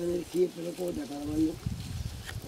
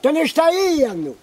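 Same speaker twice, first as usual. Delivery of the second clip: calling out; 9 semitones higher, 10 dB louder, slower than usual. Real, not acted. Calling to someone from afar.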